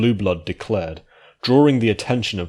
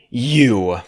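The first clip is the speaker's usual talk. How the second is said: higher, louder seething prince; through gritted teeth; 5 semitones higher, 5 dB louder than usual. Acted. Blueblood seething at Celestia, loud and high. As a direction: spit the words out in rage. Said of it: "gritted his teeth".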